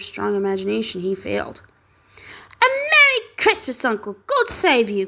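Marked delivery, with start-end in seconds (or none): 2.6-5.1 cheerful cry. Acted. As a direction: burst in with festive cheer. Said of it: "cried a cheerful voice".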